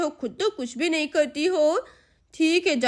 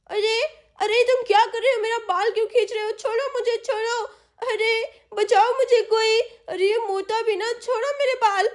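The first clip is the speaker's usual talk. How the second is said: higher pitched, yelping protests; hair pulled, crying out; 6 semitones higher than usual. Acted. Girl yelling at her brother pulling her hair, panicked. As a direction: cry out in pain and panic.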